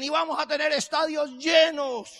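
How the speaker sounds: loud, high, proclaiming it to a crowd; rousing retold promise. Retelling a rousing stadium promise. (proclama exaltada, en voz muy alta)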